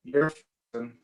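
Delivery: garbled underwater robot call audio; glitchy robotic bad-connection voice. Real, not acted. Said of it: "you sound like you're underwater robot".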